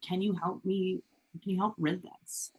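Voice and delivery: ordinary talk, nothing marked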